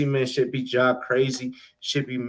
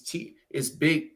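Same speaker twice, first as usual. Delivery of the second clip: normal talking voice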